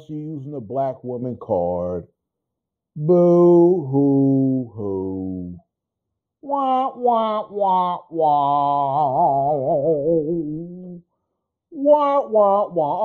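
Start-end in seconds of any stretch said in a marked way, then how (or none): 3.0-13.0 slow hammed-up boo hoo sobbing; mock wailing to ridicule her; fake crying. Mocking her playing victim.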